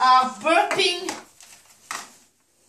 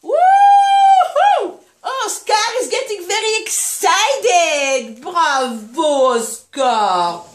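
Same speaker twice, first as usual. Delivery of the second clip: high loud whoop, then stretched-out praise; excited whooping; 4 semitones higher, 5 dB louder, much slower than usual. Real, not acted. Owner to excited puppy Oscar after feeding, jubilant whooping. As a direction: celebrate with a soaring cheer that slowly settles.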